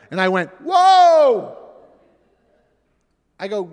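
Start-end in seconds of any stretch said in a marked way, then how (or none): 0.6-1.6 loud, high startled whoa; shocked exclamation. Real, not acted. Dad startled by his daughter, a loud shocked whoa. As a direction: jolt back in alarm.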